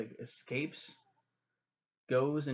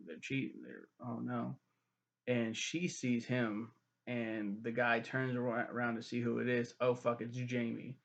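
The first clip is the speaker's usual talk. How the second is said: ordinary talk, nothing marked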